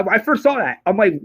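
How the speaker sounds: ordinary talk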